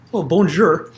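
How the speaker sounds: mock French greeting; awkward tourist bonjour. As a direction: lay on a clumsy touristy French. His clumsy French greeting on day one.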